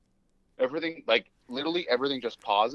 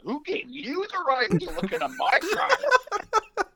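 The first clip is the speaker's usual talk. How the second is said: mock indignant laugh; 12 semitones higher, slower than usual. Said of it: "I'm like".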